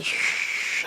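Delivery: imitated sizzle; a short hiss like hot frying; sizzling noise. Seeker with no peace inside, sizzling noise. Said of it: "a frying sausage or something".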